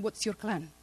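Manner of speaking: ordinary talk, nothing marked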